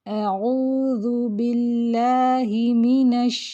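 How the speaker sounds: اعوذ باللہ منش drawn out slowly; slow model recitation. Shown as a demonstration. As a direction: recite with clear shin, unhurried. (اعوذ باللہ منش کی آہستہ تلاوت)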